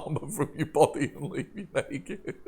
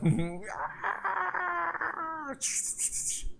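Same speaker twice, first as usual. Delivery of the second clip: very high, sustained squeal; drawn-out squeal; 4 dB quieter than usual. Acted. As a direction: let out a long, thin high squeal. Sound after describing frenzied skeletons.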